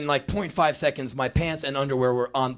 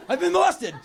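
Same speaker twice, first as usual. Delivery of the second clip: high, chirpy boasting; proud kid; 4 dB louder than usual. Retold or imitated. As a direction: show off a new word like a present. Boy proudly repeats new word.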